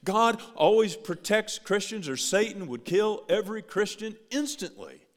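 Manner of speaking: loud, high, insistent rebuttal; full-throated pulpit declaration; preaching at full force. Acted. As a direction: hammer the answer back at them. Preacher answers the objection forcefully.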